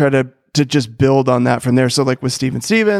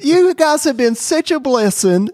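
high, sweet, gushing church lady; female children's pastor voice; 12 semitones higher than usual. Acted. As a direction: gush thanks like a sugary children's pastor.

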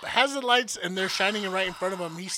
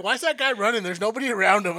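puzzled bystanders; 3 semitones higher, 8 dB louder, faster than usual. Retold or imitated. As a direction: call it out like a confused passerby. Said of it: "people were like".

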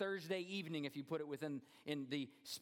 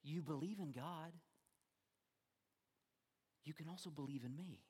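softer, unhurried reassurance; preacher showing how the verse should be read, more softly; gentle reading; 5 dB quieter than usual. Shown as a demonstration. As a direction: offer it as a gentle promise. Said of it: "this is how we should read it".

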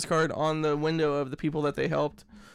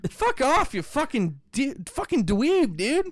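high, exasperated string of curses; swearing tirade; 6 semitones higher, slower than usual. Real, not acted. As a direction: rant at the meter maid. Swearing at imagined meter maid.

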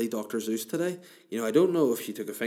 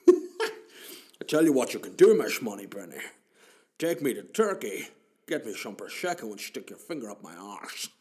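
Sean Connery impression. Bond talks to Miss Moneypenny in a Scottish accent. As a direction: ham up a Scottish Bond with every s as sh. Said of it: "Sean Connery, anything with an S".

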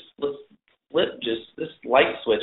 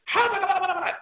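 much higher, laughing gibberish of a yelling translator; squeaky mock translator shouting nonsense syllables; imitating Russian yelling; faster than usual. Translator yelling his order in Russian, laughing mock imitation. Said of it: "and you hear".

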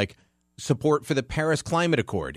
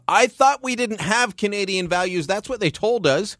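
much higher-pitched mock protest; mock incredulous; 9 semitones higher than usual. Radio host throwing the Liberals' claim back at them, mock incredulous.

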